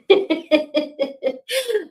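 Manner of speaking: long, high laugh that falls away; high laugh dying down; real high trailing laugh. Real, not acted. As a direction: laugh at yourself, fading out. Keeps laughing at herself.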